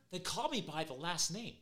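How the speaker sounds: normal talking voice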